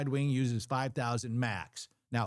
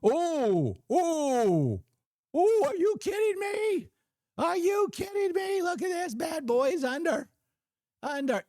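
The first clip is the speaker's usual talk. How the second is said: high, loud mock wail of a frustrated trader; squeaky hammed-up outrage, much higher and louder; imitating Maria yelling at the screen; 4 dB louder than usual. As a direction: imitate her shrieking disbelief at the chart. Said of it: "it drives me nuts when she's up there trading".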